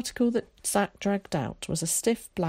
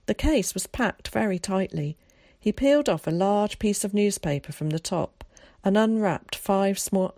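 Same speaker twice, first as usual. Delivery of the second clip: ordinary delivery, nothing marked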